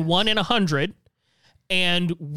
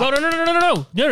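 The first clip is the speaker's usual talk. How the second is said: quick high string of no's, louder; urgent protest; 8 semitones higher, 5 dB louder, much faster than usual. Real, not acted. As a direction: cut him off, he's got it wrong. Correcting friend's misunderstanding.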